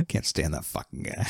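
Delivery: low, hushed aside, nearly whispered; imitated seething undertone; muttered contempt. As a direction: mutter it under your breath as her private thought. Voicing Elaine's private contempt.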